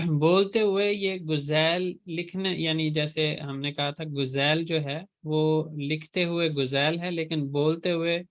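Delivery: usual speaking voice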